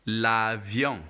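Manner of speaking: L'avion spaced out as la-vyon, nasal ending; "L'avion" in careful French, nasal final vowel; French model pronunciation. Shown as a demonstration. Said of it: "Can you repeat?".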